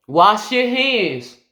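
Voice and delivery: loud, high, drawn-out reminder call. Acted. Calling to a forgetful buddy to wash up, loud and drawn out. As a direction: call it out slowly across the house. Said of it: "I'd rather call out".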